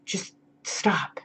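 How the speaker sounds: breathy whispered just stop, very soft; hushed, urgent whisper; whispered plea. Real, not acted. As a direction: drop to a whisper to drive it home. Pleading with nude buyers to stop.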